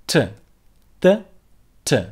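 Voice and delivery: T said sharply, tongue tip above the teeth; crisp T. Shown as a demonstration. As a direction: repeat T crisply. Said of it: "Try to follow the difference".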